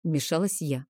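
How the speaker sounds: ordinary delivery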